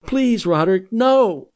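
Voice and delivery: high, loud desperate plea; frantic cry. A narrator's reading. Johanna crying out to stop the fight. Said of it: "Johanna's cries".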